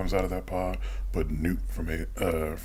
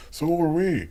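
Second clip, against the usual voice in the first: high, louder mocking retort; punched-up mock brag; mock boast; 12 semitones higher, 5 dB louder, slower than usual. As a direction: crow it as a mocking imitation of the film. Mocking the film's homage.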